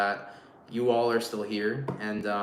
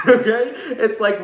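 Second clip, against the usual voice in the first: laughing; 9 semitones higher, 7 dB louder than usual. Real, not acted. Laughing about cleaning advice.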